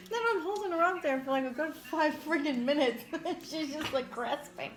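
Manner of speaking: high, laughing storytelling. Real, not acted. Mom telling friends about lifting her daughter, cracking up. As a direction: tell it through bursts of laughter.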